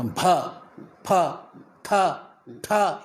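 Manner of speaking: each of بھا، پھا، تھا، ٹھا with a puff of h after the consonant; isolated aspirated syllables بھا، پھا، تھا، ٹھا. Shown as a demonstration. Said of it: "ہے سے مرکب".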